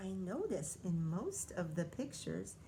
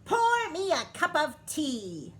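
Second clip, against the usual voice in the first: loud, high, demanding queen; bossy yell; 7 semitones higher, 10 dB louder than usual. A narrator's reading. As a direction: shout the order like a spoiled queen. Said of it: "yelled Queen Bee".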